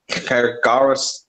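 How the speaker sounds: ordinary delivery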